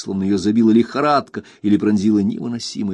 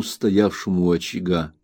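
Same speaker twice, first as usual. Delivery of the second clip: usual speaking voice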